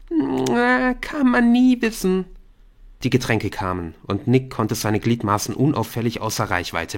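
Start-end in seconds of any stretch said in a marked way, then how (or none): 0.1-2.3 put-on high teasing girl; Bryn voice. A narrator's reading.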